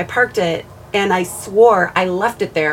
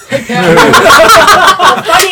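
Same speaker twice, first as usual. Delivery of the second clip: laughing; 10 semitones higher, 13 dB louder, much slower than usual. Real, not acted. Laughing through the story.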